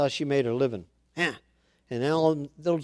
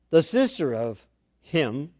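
ordinary delivery

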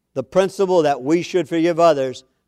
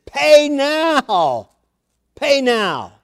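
mock demand; 7 semitones higher, much slower than usual. Servant seizes fellow by throat.

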